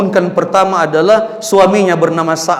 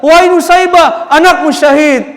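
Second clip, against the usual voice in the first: loud announcement; 9 semitones higher, 4 dB louder than usual. Acted. Messenger announces son's death.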